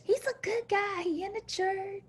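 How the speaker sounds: high, soft voice of the family; soft high-pitched approval; family's approving voice. Retold or imitated. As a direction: lift it up like relatives vouching for him. Family voice nudging her.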